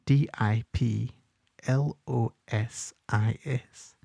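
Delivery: slow, soft letter-by-letter spelling; spelled out slowly. Shown as a demonstration. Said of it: "Just spell".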